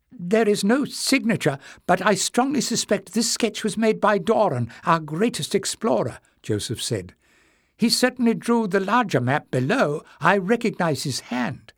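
put-on high old man's voice; an old librarian, hopeful and earnest; old Joseph, eager.

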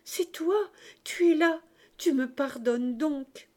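high, loud cries, slowing at the end; emotional outburst to a beloved daughter; joyful exclamation. A narrator's reading. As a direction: cry out in overwhelmed relief. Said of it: "il s'écria".

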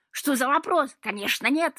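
loud, high indignant shout. A narrator's reading. Cat refusing to go to his trial, shouting. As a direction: yell a refusal. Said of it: "закричал кот".

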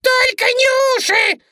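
very high, loud squealed plea; piercing panicked squeal; shrieking rabbit. A narrator's reading. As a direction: scream for your ears as the bunny. Bunny's ears are being bitten off.

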